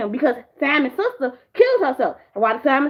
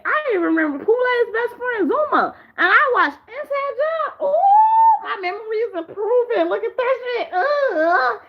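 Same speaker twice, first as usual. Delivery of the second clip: much higher, shouting, squealing; excited; 9 semitones higher, slower than usual. Real, not acted. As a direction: squeal with pride. Excited brag about memory.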